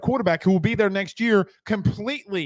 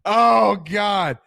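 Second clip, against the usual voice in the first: loud oh God; 2 semitones higher, 5 dB louder than usual. Real, not acted. Laughing reaction to chat.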